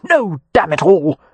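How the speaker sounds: furious. A narrator's reading. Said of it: "gripped with volcanic fury".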